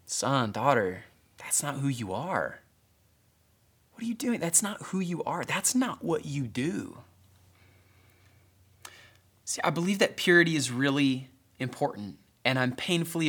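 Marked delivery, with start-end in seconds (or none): 0.0-7.0 soft, gentle voice of a loving father; tender, almost whispered reassurance; compassionate father. Retold or imitated. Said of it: "but eyes of compassion".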